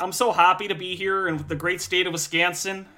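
put-on over-eager Wisconsin accent; playing the new coach at his intro, mock Midwestern accent. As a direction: gush it in a put-on Midwestern twang. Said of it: "let me do my best, like, Uber accent".

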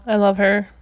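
usual speaking voice

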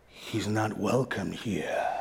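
put-on deep, slow, sinister Saruman accent. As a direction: growl it low and slow like the wizard Saruman. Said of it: "That was a good accent".

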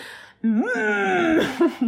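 high, loud warm-up wail; hammed-up singer's warm-up noise; mock vocal warm-up. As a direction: wail out a silly vocal exercise. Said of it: "Let me do my warm-up".

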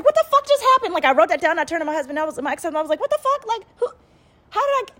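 very high freaked-out shriek; high, loud disbelief; shocked. Retold or imitated.

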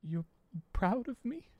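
Theodore, just sobbing, asking in disbelief; tearful disbelief. Acted. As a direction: ask it as if it can't be true. Crying Theodore surprised by praise.

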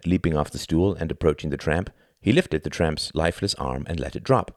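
ordinary delivery

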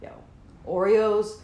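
lightly voiced hype guy; imitating brother. Stoned sister watching How It's Made, lightly talking like brother. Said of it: "That was your brother".

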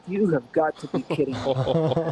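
slow, deadpan groan of disbelief; exasperated disbelief. Retold or imitated. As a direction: drag it out, fed up. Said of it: "And I'm like".

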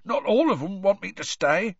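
hoarse, high-pitched objection. A narrator's reading. Upset gamekeeper saying he is unwanted, hoarse. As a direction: insist hoarsely, pitched well up. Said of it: "said Hagrid hoarsely".

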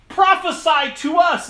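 high, loud mocking taunt; jeering. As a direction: sneer it at the blindfolded prisoner. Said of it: "they jeered".

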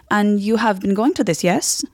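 put-on German-accented question seeking a yes; slight German accent. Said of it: "slightly German".

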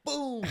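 loud, high exclaimed "boom"; big comic impact noise; imitated slap impact. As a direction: blurt a sudden explosive hit.

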